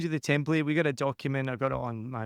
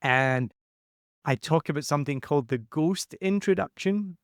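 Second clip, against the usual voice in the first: ordinary talk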